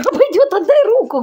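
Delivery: squealing give my hand back; high squeal. Real, not acted. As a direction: squeal it out in pain. Pained by claws, high loud.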